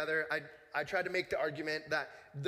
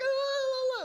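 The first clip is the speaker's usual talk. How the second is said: high, loud mock screams of pain; mock scream of a hurting child; imitated pained scream; 7 dB louder, slower than usual. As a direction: wail like a hurt kid, hammed up. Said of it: "just screaming that he was in so much pain".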